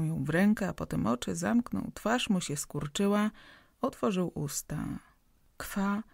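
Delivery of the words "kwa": stammered syllables, straining; stammering man struggling to start a word; stuttering. A narrator's reading. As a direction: force each syllable out, stuck. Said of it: "wybełkotał, krzywiąc się i zaciskając pięści".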